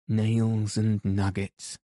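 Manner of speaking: plain reading voice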